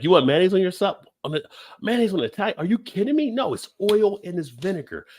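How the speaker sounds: high, outraged disbelief; hammed-up indignant rant, pitched high; mock outrage. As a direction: play the appalled local scolding the sandwich maker. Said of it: "They were like".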